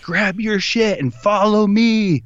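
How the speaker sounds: slow, drawn-out high holler; yelled order. Retold or imitated. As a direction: imitate a huge Ranger commanding recruits. Retold big Ranger's order.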